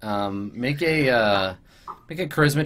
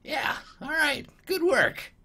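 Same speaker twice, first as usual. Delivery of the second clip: pleased goblin lawyer; 5 semitones higher than usual. Acted. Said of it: "he's like".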